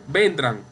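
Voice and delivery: vendrán mispronounced as VEN-dran; wrong first-syllable stress. Shown as a demonstration. Teacher imitates what you never hear, vendrán stressed on VEN. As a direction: push VEN up in vendrán, falling after. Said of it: "nunca ha escuchado a una persona que dice".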